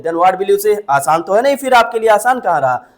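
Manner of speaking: ordinary talk, nothing marked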